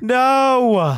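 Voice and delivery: high, drawn-out wail; protesting cry. Real, not acted. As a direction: yell out in protest at the threat. Hears plan to snipe them.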